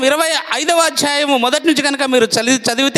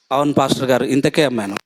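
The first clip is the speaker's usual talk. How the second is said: lower, meek little answer; lying reply; 13 semitones lower, faster than usual. Acted. Ananias lying to the apostle, low and meek. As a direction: answer low and innocent, hiding the lie.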